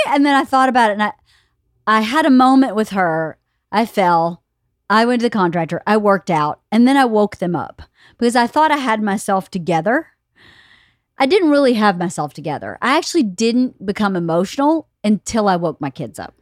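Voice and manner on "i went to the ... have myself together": normal talking voice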